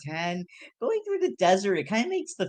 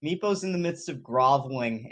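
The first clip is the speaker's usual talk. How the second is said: usual speaking voice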